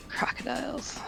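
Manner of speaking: whispered dread of the creature; soft, voiceless horror; dismayed whisper. Acted. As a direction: breathe the word out in dismay. Character shaking at word crocodile.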